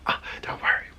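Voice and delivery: hushed whisper to herself; soft self-soothing whisper. Retold or imitated. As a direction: whisper to calm yourself down.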